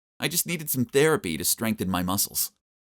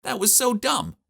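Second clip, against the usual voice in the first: high-pitched indignant complaint; dismayed, voice jumping way up; exasperated disbelief; 13 semitones higher than usual. Acted. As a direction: protest the embarrassing truth. Dismayed at silly cause of coma.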